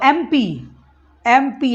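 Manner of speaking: MP and Member of Parliament said slowly and deliberately; slow demo. Shown as a demonstration. Teacher slowly expands the abbreviation MP. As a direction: spell it out slowly for learners. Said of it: "MP का फिर से sound सुनना है".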